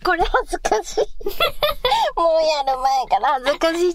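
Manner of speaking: high giggly flustered talk; embarrassed laughing. Real, not acted.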